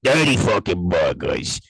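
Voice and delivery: slow, loud, drawn-out nickname; hyped nickname shout. Real, not acted. Friend hyping the home run to his co-host, slow loud shout. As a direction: belt out the nickname slowly.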